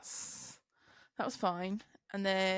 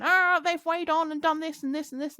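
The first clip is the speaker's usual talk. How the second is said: mock whinge, higher pitched; whinging complaint; 8 semitones higher, 3 dB louder than usual. Weighbridge worker moaning to the transport manager, mock whinge. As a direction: imitate someone kicking off, whinging. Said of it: "she'd whinged and kicked off".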